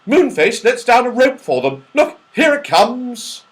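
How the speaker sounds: very high, loud amused squirrel; laughing squirrel. Acted. Squirrel amused by Franny's question, very high and loud. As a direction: laugh off the idea. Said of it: "he said".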